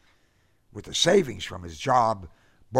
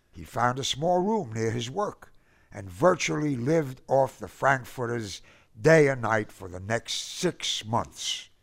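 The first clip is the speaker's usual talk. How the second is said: usual speaking voice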